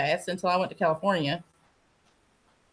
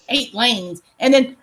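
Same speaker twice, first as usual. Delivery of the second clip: ordinary talk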